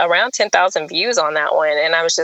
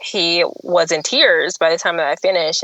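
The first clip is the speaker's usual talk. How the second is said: normal talking voice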